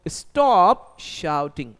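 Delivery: very slow, each word laid out for the class; slow model sentence. Shown as a demonstration. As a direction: dictate it slowly like a teacher. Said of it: "चिल्लाना बंद करो". (बहुत धीरे, साफ़-साफ़)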